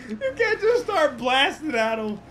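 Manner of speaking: helpless laughter breaking into the words; high, loud, struggling to speak through laughs; laughing. Real, not acted. Helpless laughter while explaining the rule.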